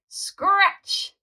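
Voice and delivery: high squeaky scratch sound; record scratch. A narrator's reading. DJ stopping the music with a scratch.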